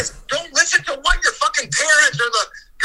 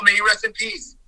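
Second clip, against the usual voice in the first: usual speaking voice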